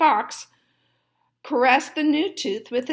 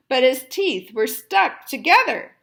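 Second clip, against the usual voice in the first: ordinary delivery, nothing marked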